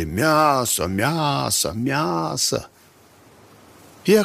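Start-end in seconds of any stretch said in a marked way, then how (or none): 0.0-2.7 drawn-out, higher chant of menace; eerie repeated chant, slightly hushed; hissing chorus. A narrator's reading. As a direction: imitate a hissing group, each word stretched. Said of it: "тоже зашипели".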